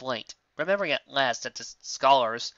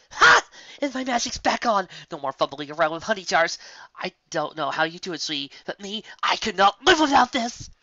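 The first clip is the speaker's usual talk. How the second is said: high and loud, spilling over with glee; excited cheer; 7 semitones higher, 7 dB louder than usual. Acted. Lyra cheering to Bon Bon about her magic, high and loud. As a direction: crow it out like she's won the lottery.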